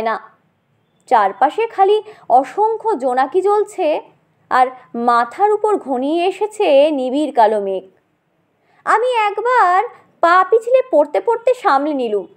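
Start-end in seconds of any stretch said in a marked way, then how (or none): none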